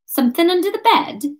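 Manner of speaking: high, probing character voice; pointed question. Acted. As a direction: press him on his excuse. Kate challenges Ryan in character.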